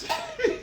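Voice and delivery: sudden high cackle, trailing off; high burst of laughter. Real, not acted. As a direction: let a high laugh escape. Laugh after outburst.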